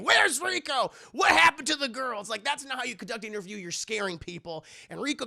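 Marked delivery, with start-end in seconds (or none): 0.0-2.2 high, loud bursting-in shout. Retold or imitated. Shinji bursting into the apartment complex, shouting questions. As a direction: shout it like a child barging in. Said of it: "just begins shouting".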